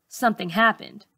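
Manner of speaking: usual speaking voice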